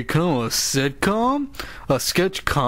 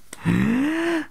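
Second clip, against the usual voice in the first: dramatic gasp; 12 semitones higher than usual. Acted. Announcer balks at intro.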